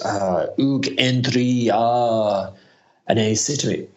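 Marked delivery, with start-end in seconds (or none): 0.0-2.5 imitating a French accent, slow and higher; mock French drawl on the name. French accent while greeting Hugh at a party. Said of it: "he puts on this French voice".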